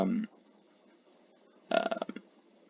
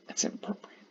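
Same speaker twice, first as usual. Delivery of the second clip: soft unvoiced whisper; 11 dB quieter than usual. Real, not acted. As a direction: whisper it as an aside. Whispered aside.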